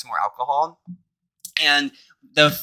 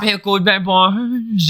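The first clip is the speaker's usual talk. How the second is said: laughing cursive; 6 semitones higher, much slower than usual. Shown as a demonstration. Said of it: "Now let's do it in cursive".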